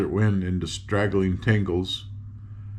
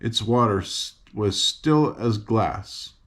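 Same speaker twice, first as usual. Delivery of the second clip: usual speaking voice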